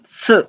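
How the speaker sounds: S as a short high falling syllable; falling Mandarin tone on S; fourth tone. Shown as a demonstration. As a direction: cut S short, dropping from high. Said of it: "this is fourth tone".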